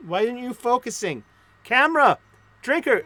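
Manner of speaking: loud, high burst of impatience; exasperated shout. Real, not acted. Streamer yelling at a camera that won't focus, exasperated. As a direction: snap loudly at misbehaving equipment.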